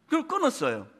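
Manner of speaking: ordinary delivery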